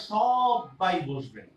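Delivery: সবাই said as সব্বাই, b held long and emphatic; doubled consonant. Shown as a demonstration. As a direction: stress সবাই with a doubled b, slowly. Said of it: "এটা না বলে দেখেন আমরা সবাই বসবেন". (জোর দিয়ে সব্বাই উচ্চারণ)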